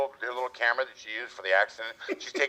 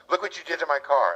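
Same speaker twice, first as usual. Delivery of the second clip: retold yelling; 8 semitones higher than usual. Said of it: "starts yelling at me".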